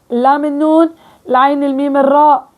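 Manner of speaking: usual speaking voice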